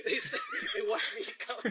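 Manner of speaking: high, breathless laughing repeat; cracking up. Real, not acted. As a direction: barely get it out through laughter. Cracking up at friend's story.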